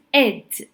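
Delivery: "id" with both vowel and D sounded; "id" as written. Shown as a demonstration. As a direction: say "id" with a clear short vowel before the D. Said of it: "it's pronounced how you see it".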